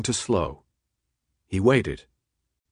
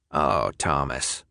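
much lower, slow and quiet; like breaking sad news to a small child; 8 semitones lower, 3 dB quieter, slower than usual. A narrator's reading. Ratman pitying Thomas, slow and quiet. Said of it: "said it quietly, as if delivering sad news to a small child".

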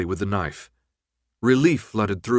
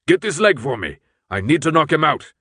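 urgent shout; 5 semitones higher, 5 dB louder than usual. Acted. Urgent orders during struggle.

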